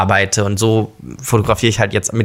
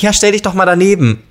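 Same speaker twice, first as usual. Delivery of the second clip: security guard; 8 semitones higher than usual. Retold or imitated.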